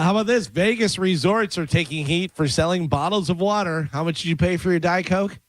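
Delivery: ordinary talk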